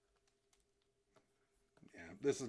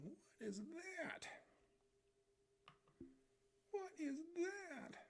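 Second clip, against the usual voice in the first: much higher and slow, whining; cartoon bewilderment; slower than usual. Streamer voicing his drawn guy, slow high whine.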